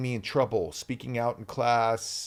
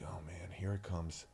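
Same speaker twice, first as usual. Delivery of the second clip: much softer, low inner groan of dread; 7 semitones lower, 15 dB quieter than usual. Retold or imitated. Young actor bracing for a scolding, soft dread. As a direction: mutter it to yourself, bracing for trouble.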